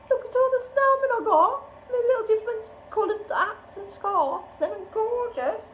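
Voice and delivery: very high, excited voice; thrilled squealing talk. Real, not acted. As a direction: squeal the words in real delight. Thrilled by snowmen stickers.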